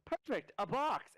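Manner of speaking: high, slow delighted cry; excited goofy voice. Acted. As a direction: squeal it like a happy goof. Gino voiced in DM narration.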